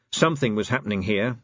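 ordinary delivery

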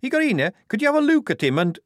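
high, fast nervous voice; worried and hurrying. A narrator's reading. Shufti fretting to Igorina about the guard, high, fast and nervous. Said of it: "Shufti began nervously".

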